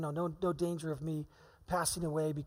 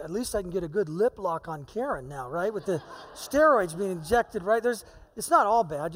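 laughing; 7 dB louder than usual. Real, not acted. Joking about steroid lips, laughing.

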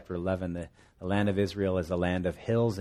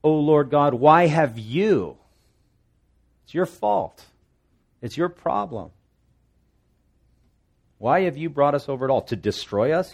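higher, louder blaming outburst; accusing cry; 5 semitones higher, 6 dB louder than usual. Retold or imitated. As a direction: throw the blame at God. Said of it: "and he says".

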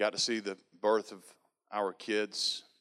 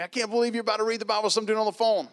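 high, fast, louder teasing jeer; mock him, pitched up and quick; mocking roommate; 12 semitones higher, 5 dB louder, faster than usual.

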